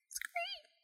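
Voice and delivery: tiny, high, whispered squeak; barely audible little bat cry; soft bat screech. A narrator's reading. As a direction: squeak very faintly and gently. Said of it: "he said softly".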